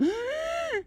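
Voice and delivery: very high drawn-out squeak; strange squeal. Pretend. Player ending a mock proclamation, piercing squeal. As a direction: let out an unexplained shrill noise. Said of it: "Don't ask that noise what was".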